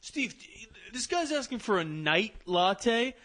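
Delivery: high, loud call across the café. Retold or imitated. Barista calling out to Steve about the odd order, high and loud. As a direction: yell for a coworker across the shop.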